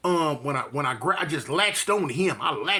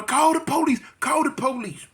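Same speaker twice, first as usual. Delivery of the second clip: hollering for police; 7 semitones higher than usual. Retold or imitated. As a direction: yell for the police. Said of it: "I heard somebody holler".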